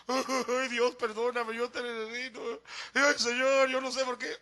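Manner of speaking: hammed-up wailing plea to God; mock tearful prayer. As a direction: imitate someone blubbering at the altar. Acts out his tearful conversion prayer.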